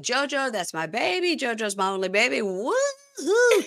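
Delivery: lightly imitated wail, rising to a high howl at the end; a half-joking sob for her baby; mock wailing. Woman mourning her dog, a touch of mock wailing. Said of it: "So she's wailing".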